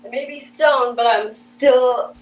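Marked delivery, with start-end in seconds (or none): none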